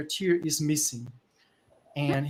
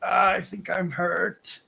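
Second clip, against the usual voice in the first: slow, higher, louder groaned line; hurt creature; 4 semitones higher, 5 dB louder, slower than usual. Acted. Game master as the upper half in the mud, slow hurt voice. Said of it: "saying, like".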